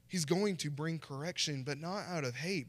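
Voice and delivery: normal talking voice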